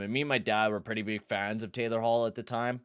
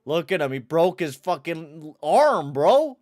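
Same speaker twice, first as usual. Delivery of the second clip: rising higher and louder to a shrieked end; gleeful yell; 7 semitones higher, 8 dB louder, slower than usual. Real, not acted. Streamer gloating to chat over replay, gleeful yell. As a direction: crow louder and higher each word.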